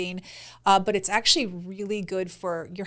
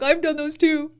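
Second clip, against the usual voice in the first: higher, louder mimicry of his bragging; mocking boyfriend voice; 6 semitones higher, 4 dB louder than usual. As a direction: tease him with a put-on eager voice. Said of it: "Do I sound like that? That's the voice?".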